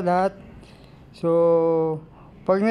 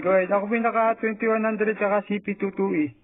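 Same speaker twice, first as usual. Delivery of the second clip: higher, raised on-air reply; radio operator voice; 4 semitones higher than usual. Acted. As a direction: speak up into the mic. Signal report on radio.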